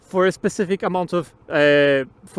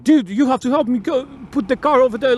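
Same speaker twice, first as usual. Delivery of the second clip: higher-pitched, demanding mock order; pushy attitude; 5 semitones higher than usual. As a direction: imitate someone rudely demanding help. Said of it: "came over to me with fucking attitude".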